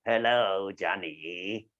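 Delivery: sly villain greeting; sneaky character. Shown as a demonstration. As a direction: sneak the words out low and sly. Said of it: "like a sneaky character".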